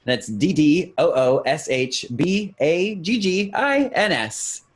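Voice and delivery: usual speaking voice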